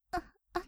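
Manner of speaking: mock high little gasps copying him; imitated moan. Maid mimicking her master's reaction, mock gasps. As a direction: echo his squeaks, teasingly. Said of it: "私は真似をしてみただけですよ".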